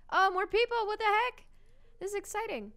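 high, loud burst of delight; thrilled shout at new villagers; excited. Real, not acted. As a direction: shout it out, thrilled to see people.

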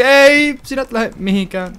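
very high sudden "Ei", then a stern voice; alarmed shout. Real, not acted. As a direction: stop it from leaving. Item drifting away in game. (kovaääninen huuto)

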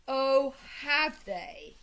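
slow, loud, affronted retort; outraged challenge; indignant. A narrator's reading. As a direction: draw it out, ready for a fight. Toad indignant at the invasion.

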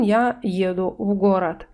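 ordinary talk, nothing marked

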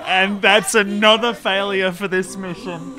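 frustrated loud. Real, not acted. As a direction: vent the failure. Mission failed outburst.

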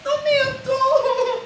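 high, whiny mock fright, slowing to the end; frightened girlfriend. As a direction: play a girl startled and pleading. Acting a scared sweet girlfriend.

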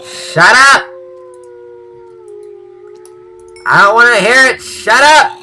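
loud high yell at a mob. Real, not acted. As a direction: shout it out, rising sharply. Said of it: "I'm yelling at a mob".

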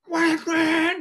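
high-pitched honking noise; imitated car horn. Driver passing the neighbor's house, imitated horn blasts. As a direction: honk it out like a horn. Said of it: "Or just blow the horn".

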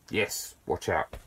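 slow, low, soft warning; threatening. Real, not acted. Vlogger telling viewers to watch out, sounding threatening. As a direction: say it like an unintended threat, slowly and quietly. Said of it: "That really sounded really threatening".

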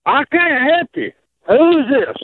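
ordinary talk, nothing marked

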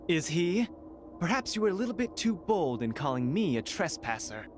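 usual speaking voice

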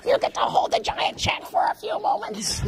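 high hissing whisper; quick, hushed and witchy; witch voice. Real, not acted. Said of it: "I sound like a witch".

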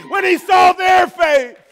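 high, loud and slow, leaning on saw; shouted preaching. Acted. Pastor crying out to the congregation, loud and slow. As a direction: ring it out to the back row. Climax of the sermon list.

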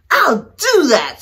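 loud, high screech; the grouchy ladybug screeches a reply. Acted. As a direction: shriek the challenge back. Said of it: "screeched the grouchy ladybug".

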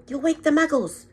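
stern McGonagall. A narrator's reading. McGonagall scolds Hagrid.

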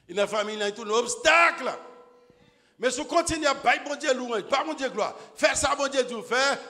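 loud, high fervent preaching; full-force preaching. Acted. Preacher driving home the fall of Jericho, fervent shout. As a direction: preach it to the rafters.

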